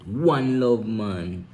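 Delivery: slow, drawn-out patois greeting; Jamaican accent. Shown as a demonstration. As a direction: lay on the island sound. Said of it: "Real Jamaican".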